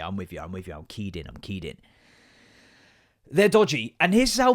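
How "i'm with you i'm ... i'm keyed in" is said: soft fading murmur; quiet. Acted. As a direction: trail off softly. Fading reassurance.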